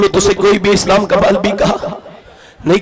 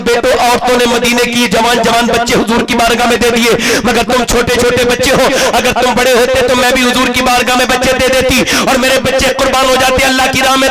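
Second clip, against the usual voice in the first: steady, projected preaching on a level pitch; insistent near-monotone sermon narration; impassioned sermon storytelling; 3 semitones higher, 4 dB louder than usual. Real, not acted. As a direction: tell the story to a big crowd, driving on phrase by phrase.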